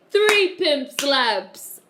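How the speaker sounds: the Count. Acted. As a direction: stretch it out as a booming counting vampire. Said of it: "What did Dave Chappelle say?".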